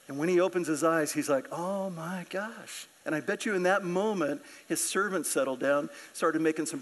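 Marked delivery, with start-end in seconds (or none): 1.5-2.9 slow, soft amazement; drawn-out, quiet wonder; hushed awe. Retold or imitated. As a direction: act stunned by a sudden vision. Servant sees the fiery chariots.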